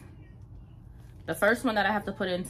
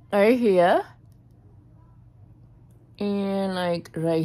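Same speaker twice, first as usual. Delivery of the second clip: speaking with teeth bared; finger at mouth; 5 dB louder than usual. Real, not acted. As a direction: lips pulled back by finger. Seen 0: Long fingernail held at her bared front teeth while speaking.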